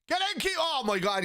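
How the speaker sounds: very high, thrilled yell that settles into disbelief; goal celebration. Real, not acted. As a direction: burst out in delight at the goal.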